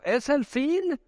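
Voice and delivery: high rising wail, imitated; high-pitched anxious question, acted; demon's cry. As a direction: play a demon dreading the end. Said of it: "Siempre están diciendo".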